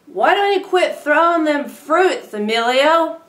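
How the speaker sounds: high, loud shrill scolding mother; nagging mom. Acted. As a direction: yell it like a mean mom in a checkout line. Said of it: "His mom was a true bitch about it".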